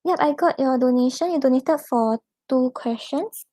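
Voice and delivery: usual speaking voice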